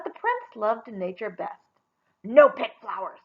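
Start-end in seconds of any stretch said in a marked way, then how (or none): 2.2-3.2 loud, rough-barked command; rough bellow. Acted. Prince bellowing at the Bigfoot women, loud rough shout. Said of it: "he bellowed at them in a voice as rough as bark".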